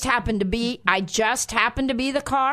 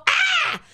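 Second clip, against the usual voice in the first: sudden high yelp of alarm; retold startled scream. Pastor reliving a scare while driving, a sudden high scream. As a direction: cry out as a man steps in front of your car. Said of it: "And I scream".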